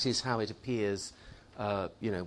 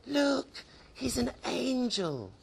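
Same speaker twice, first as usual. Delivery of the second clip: much higher, wondering voice; awed young students; 10 semitones higher, 3 dB quieter, slower than usual. Acted. Poor young students gazing at the statue, high and awed. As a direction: say it like a student seeing an angel. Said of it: "and they say".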